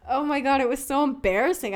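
high and loud, laughing at herself; exasperated outburst. Retold or imitated. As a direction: blurt it out, embarrassed at the memory. Retelling embarrassing crying at dinner.

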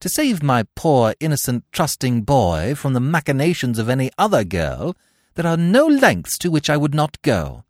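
loud, fierce declaration; defiant vow. Acted. Cecily rising to defy Gwendolen. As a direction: stand up and declare war.

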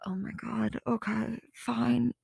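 very soft, defeated giving-in; reluctant resignation. Retold or imitated. Agreeing to take the stranger's photo, quiet reluctant resignation. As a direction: give in under your breath.

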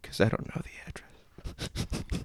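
secretive whisper in the ear. Pretend. Child whispering in the wolf's ear, whispered. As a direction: whisper it very softly and slowly. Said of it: "can I whisper it in your ear".

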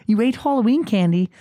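high-pitched astonished questioning; incredulous dad. Retold or imitated. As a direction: squawk it in parental disbelief. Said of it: "I'm like".

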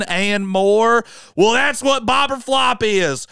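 high, loud country twang sales pitch; over-the-top Southern drawl pitching a podcast to new listeners; hammed-up country accent. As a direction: sell it like a sleazy country huckster, high and pushy. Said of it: "I'm gonna stop doing the country accent".

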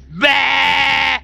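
high, loud monster bark; mimic's spit cry. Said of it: "Says the spitting mimic".

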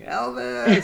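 high, loud imitation of a screaming fan; mock scream. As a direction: shriek it out like a starstruck fan. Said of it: "I just remember Bryony screaming".

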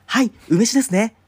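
imitating a loud, high-pitched waiter; high, booming customer-service reply. Waiter answering a discreet customer, loud and high. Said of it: "めっちゃ大きな声で言ったわけよ".

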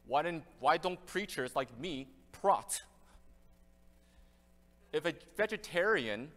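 ordinary talk

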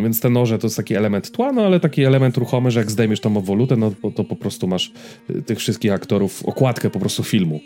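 ordinary talk

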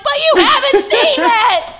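high, loud, slow, laughing disbelief; can't believe the logic; to her friend, laughing incredulity; incredulous. Retold or imitated.

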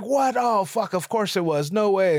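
the host caught off guard, blurting it out; surprised. Retold or imitated. Host hearing the boat insurance ad, surprised outburst.

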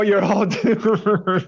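high, slow doom voice breaking into laughter; creepy doom voice, laughing. Retold or imitated. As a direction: copy the creepy warning, cracking up. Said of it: "the creepy guy that's like".

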